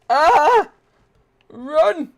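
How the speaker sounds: high, loud panicked yelp; panicked shriek. Real, not acted. Gamer shrieking at an enemy, panicked.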